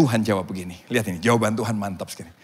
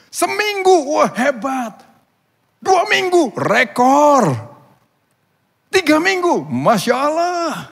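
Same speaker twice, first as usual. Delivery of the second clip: high, loud cheers for each record; awed excitement; 13 semitones higher, 7 dB louder, slower than usual. Acted. Villagers marvel at each longer week count, awed excitement. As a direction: announce each feat, then marvel loudly.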